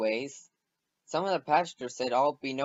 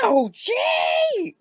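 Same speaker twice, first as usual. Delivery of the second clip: high, loud cry of pain; loud ouch of pain, much higher; pained yelp; 6 dB louder than usual. Acted. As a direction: yelp as you get hit. James bumped by Thomas.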